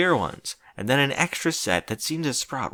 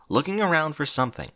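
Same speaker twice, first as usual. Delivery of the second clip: ordinary delivery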